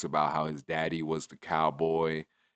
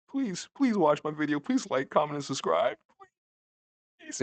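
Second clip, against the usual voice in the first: pleading; 9 semitones higher than usual. Real, not acted. Begging viewers to subscribe.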